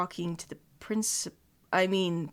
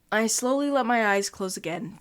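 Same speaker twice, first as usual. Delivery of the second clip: ordinary delivery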